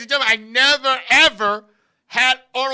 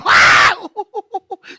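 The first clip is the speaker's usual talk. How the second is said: imitated laughing fit; 6 semitones higher than usual. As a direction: burst out in exaggerated laughter. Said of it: "and I'm laughing".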